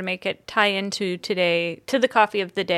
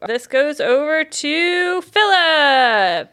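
slow drawn-out winner reveal; hyped giveaway announcement; 5 semitones higher, 4 dB louder, much slower than usual. Acted. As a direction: stretch out the name like a host reveal.

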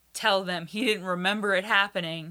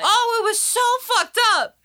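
much higher and louder burst of shock; outraged exclaim; 14 semitones higher, 7 dB louder than usual. Real, not acted. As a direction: let the horror spill out loud. Exclaiming about the movie's plot.

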